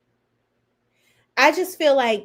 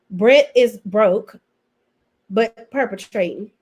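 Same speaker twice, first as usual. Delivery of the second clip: normal talking voice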